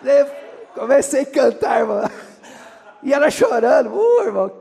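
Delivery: loud, high excited exclaim; excited cry. Real, not acted. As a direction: cry it out to the congregation. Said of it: "preacher excited at peak".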